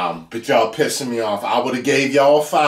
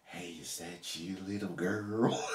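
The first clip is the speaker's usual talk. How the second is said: creepy; 7 semitones lower, 19 dB quieter than usual. Shown as a demonstration. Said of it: "You never say".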